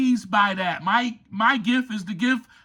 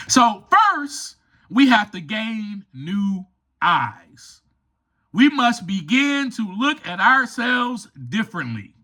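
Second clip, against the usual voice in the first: usual speaking voice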